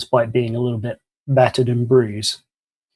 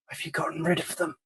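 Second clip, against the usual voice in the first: weak injured voice; 4 semitones higher, 11 dB quieter, faster than usual. Acted. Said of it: "He kind of sits up weakly".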